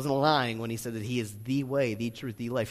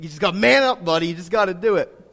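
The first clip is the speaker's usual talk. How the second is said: coaching shout; 3 semitones higher, 9 dB louder, much faster than usual. Retold or imitated.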